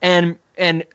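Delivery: ordinary talk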